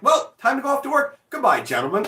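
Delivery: a hammed-up cheery goodbye to the neighbours; mock polite farewell. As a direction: fake courtesy while rubbing it in. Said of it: "And then I'm like".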